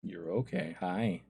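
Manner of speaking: soft, gentle reassurance; real soothing. Real, not acted. Player greets Kronos, softly reassuring. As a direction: hush and comfort a pet.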